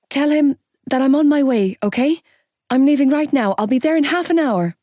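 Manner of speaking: high, loud, hurried urgency; racing out the door; urgent. A narrator's reading. Rushing to the hospital.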